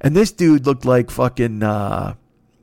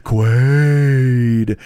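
loud, strange growl of a mutant in the chest; Kuato voice; 4 dB louder, much slower than usual. Retold or imitated. Old self bursting from the chest, loud slow alien voice. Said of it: "old me lives inside me like a fucking Quato".

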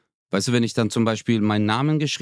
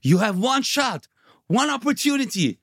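hyped motivational quote; 10 semitones higher than usual. Retold or imitated. Teammate quoting [name] to fire up his partner, high and hyped. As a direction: re-enact pumping up a teammate with rap lyrics. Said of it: "hab ich gesagt".

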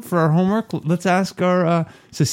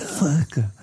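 soft unvoiced whisper; 4 dB quieter than usual. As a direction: whisper it back. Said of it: "whispers in his ear".

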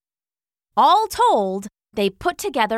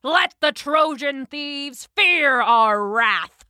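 slow, raised rallying call; Odysseus's battle cry; 4 semitones higher, slower than usual. A narrator's reading. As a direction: rouse the troops with a drawn-out war cry. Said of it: "cried Odysseus".

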